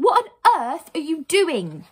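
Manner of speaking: high, loud, slow scolding; indignant cry. Acted. Heroine confronts boys who crashed a car, indignant cry. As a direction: demand an answer, drawing it out. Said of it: "Lucy cried".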